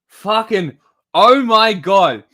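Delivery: exasperated outburst. Real, not acted. Exasperated by the video's wording.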